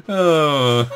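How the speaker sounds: loud laughing oh; a real laugh bursting out; burst of laughter. Real, not acted. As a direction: give in to the laugh.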